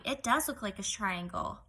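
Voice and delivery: ordinary talk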